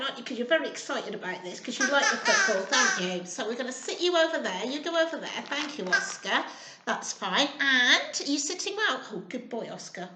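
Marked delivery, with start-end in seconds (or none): none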